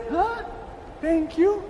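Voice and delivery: high, rising, disbelieving question; puzzled surprise. Retold or imitated. Other frog baffled at being thanked, puzzled surprise.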